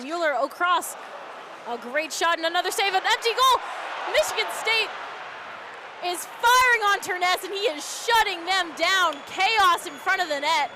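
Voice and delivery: loud, high frantic call; excited play-by-play. Real, not acted. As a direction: yell the chaos at the net. Empty net scramble call.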